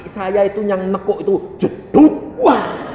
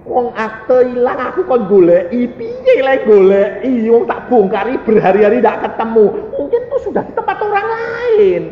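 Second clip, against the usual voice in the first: louder, raised complaining voice; distressed old woman; 6 semitones higher, 5 dB louder than usual. Retold or imitated. Old clerk pleading over the lost deed, raised and exasperated. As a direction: lament the loss in exasperated Javanese. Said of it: "dia bilang gini".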